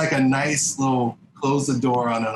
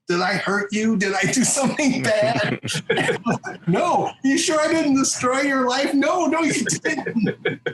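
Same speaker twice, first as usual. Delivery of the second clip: laughing; 13 semitones higher, slower than usual. Retold or imitated. Neil worried, laughing retold exchange.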